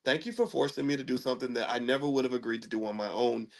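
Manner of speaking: soft, low heartfelt thanks; sincere thanks. Retold or imitated. Husband thanking his wife, soft and sincere. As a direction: thank her gently and sincerely.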